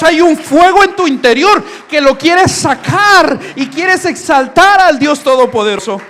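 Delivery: loud, fervent sermon cry; full-force preaching. Acted. Pastor exhorts the congregation to worship, loud fervent preaching. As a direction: preach it out with fire.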